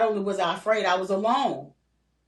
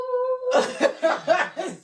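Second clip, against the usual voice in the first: sudden bright laugh bursting out; high burst of laughter; 4 dB louder than usual. Real, not acted. Grandmother laughing at her grandson's blunt question, high laugh. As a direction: laugh out loud in disbelief.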